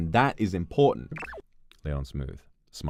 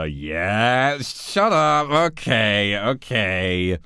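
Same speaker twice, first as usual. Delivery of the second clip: loud, raised, drawn-out cheer; excited outburst; 6 semitones higher, 7 dB louder, much slower than usual. Real, not acted. Player crows at game after guessing right, excited outburst. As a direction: crow it loudly over being right.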